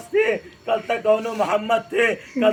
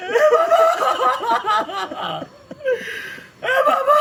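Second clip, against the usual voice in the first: wailing cry. Acted. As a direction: cry out to the lost father, voice breaking upward. Sakina cries out after slap.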